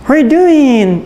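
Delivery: light mock English accent, lifted pitch; playful imitation of a native English speaker. Preacher shows how Indonesians sound English, mock English accent. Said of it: "kayak orang Inggris".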